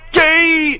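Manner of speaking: high shouted jab; mock shout. Real, not acted. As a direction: yell it to tease. Teasing call-out in banter.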